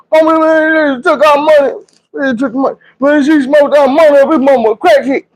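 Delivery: very high, hammed-up kid wail; crying to mama. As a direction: tattle to mama in mock tears. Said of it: "we walking home crying".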